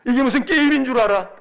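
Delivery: acted angry big-brother scolding; browbeating rebuke. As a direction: bark it at your kid brother. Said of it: "이러고 막 윽박 지릅니다".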